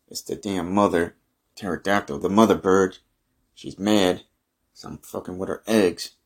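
normal talking voice

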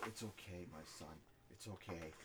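low, soft fatherly reassurance; mock consoling. Going in there to comfort him, low and soothing. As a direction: soothe him quietly, pitched right down. Acts out consoling someone yelling at himself.

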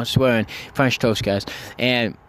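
ordinary talk

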